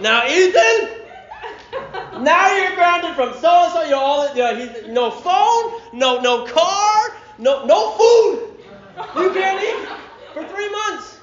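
high-pitched, hammed-up parental outrage; exasperated father. Preacher plays dad grounding slap-happy son, exasperated high voice. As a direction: pile up punishments in a high, exasperated voice.

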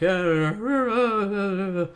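high, stuttering mock sobs; hammed-up blubbering fawn; crying fawn. Friend imitating the crying fawn for laughs, mock sobbing. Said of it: "That's my impression of a crying fawn".

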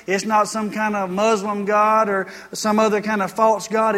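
ordinary delivery

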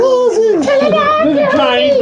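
high drawn-out voice. Retold or imitated. Retelling the movie scene.

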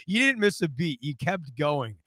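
usual speaking voice

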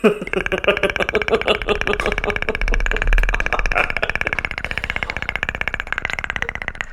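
breathy unvoiced wheeze; long wheezing laugh. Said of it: "stop it".

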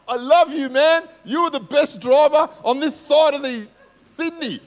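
loud, high sarcastic bellow of an angry driver; mock road-rage yell. Preacher mocking the enraged driver chasing him, mock furious yell. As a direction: yell it like a furious driver, but it's a joke.

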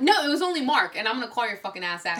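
ordinary talk, nothing marked

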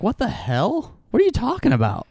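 high, baffled disbelief; incredulous. Retold or imitated. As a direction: sound stunned and confused. Imagined reaction from 2018.

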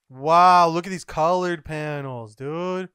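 loud, bursting wow; loudly amazed, as if showing a friend; excited awe. Real, not acted. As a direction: exclaim it, hitting WOW loud. Same reaction, now exclaimed.

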